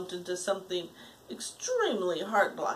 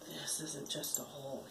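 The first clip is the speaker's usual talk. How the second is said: excited whisper; 6 semitones lower, 13 dB quieter than usual. A narrator's reading. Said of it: "he thought excitedly".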